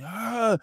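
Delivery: high mock shriek; imitated scream. Ex-cop mimics the woman screaming again, high. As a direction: yelp it high like she lost it. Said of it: "And she kept screaming".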